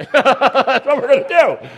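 loud, high, laughing words. Real, not acted. Preacher jokes about the wrong answer, laughing. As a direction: crack up while saying it.

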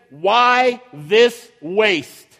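slow, scornful why this waste; indignant disciples. Acted. Disciples scolding the woman, slow indignant scorn. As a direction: drag it out in indignant disbelief. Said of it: "The disciples got indignant and they said".

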